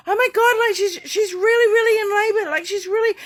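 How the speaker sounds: high, loud, frantic imitation of a birthing mother's partner; panicked partner. Midwife retelling a labouring mother's partner calling, high and frantic. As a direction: blurt it out like a panicking partner on the phone. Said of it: "the partner's going".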